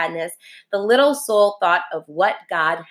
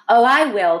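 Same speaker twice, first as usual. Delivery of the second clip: a touch of eager, louder promise; eager cry; 4 semitones higher, 4 dB louder than usual. Acted. Little soul promises friendly soul, eager cry. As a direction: promise with feeling. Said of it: "cried the little soul".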